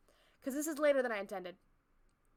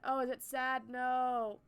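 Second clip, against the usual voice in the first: ordinary delivery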